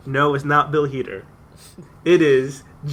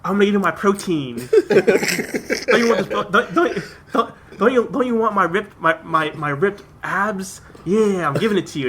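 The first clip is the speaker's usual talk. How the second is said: mock meathead; 6 semitones higher than usual. Retelling Cena's dirty talk scene, laughing hammed-up brag. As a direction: stretch the flex while laughing. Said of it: "and he's like".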